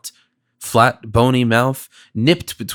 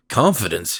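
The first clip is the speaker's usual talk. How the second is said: squeaked; 5 semitones lower than usual. A narrator's reading. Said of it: "he squeaked, with changed manner".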